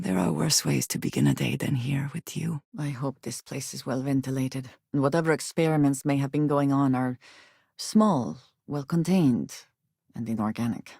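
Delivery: usual speaking voice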